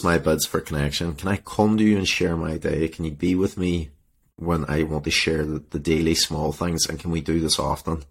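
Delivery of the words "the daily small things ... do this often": ordinary talk, nothing marked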